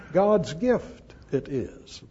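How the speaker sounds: slow "God's gift it is" leaning on "God's"; slow, deliberate weight on "God's"; stress on God's. Shown as a demonstration. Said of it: "Emphasis on theou".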